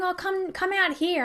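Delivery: high, imitated coaxing of a partner. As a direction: playfully mimic someone beckoning you over. Retelling boyfriend coaxing her outside.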